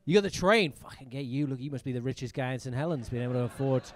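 ordinary talk, nothing marked

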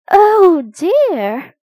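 high, loud exclamation of dismay. Acted. Teacher dismayed at upside-down classroom.

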